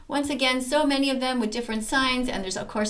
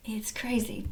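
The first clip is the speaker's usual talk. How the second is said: breathless whisper, voice dropped right down; whispered aside; 8 dB quieter, slower than usual. Real, not acted. Vlogger whispering to viewers about triggered people. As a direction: let it slip out as a hushed aside. Aside after shopping mall story.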